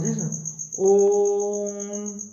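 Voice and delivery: ओम held very long and slow; long drawn Om. Shown as a demonstration. Teacher showing the class a long drawn ओम. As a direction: chant ओम with a stretched vowel. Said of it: "लंबा आवाज़ खींच कर बोलते हैं".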